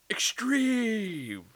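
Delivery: mock bro yell. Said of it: "the douchey neighbors who are like".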